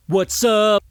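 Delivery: ordinary talk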